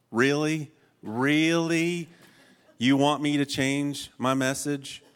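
pouty, put-upon complaint; incredulous lament. Retold or imitated. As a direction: stretch it out in disbelief. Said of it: "Jeremiah is lamenting towards God".

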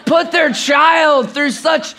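slow, hammed-up lament; mock-anguished outcry, stretched out; discouraged protest. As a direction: imitate a daughter dramatically protesting her suffering. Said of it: "she was so discouraged".

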